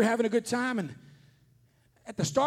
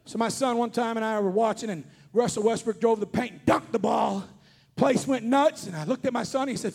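usual speaking voice

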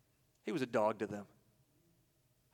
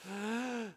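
high, drawn-out gasp for air; strained intake of breath, acted out; 7 semitones higher, 7 dB quieter than usual. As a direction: gasp like someone suffocating. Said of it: "constant screaming and".

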